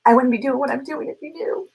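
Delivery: real emotion welling up mid-sentence; teary-eyed. Real, not acted. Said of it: "I'm gonna get teary-eyed".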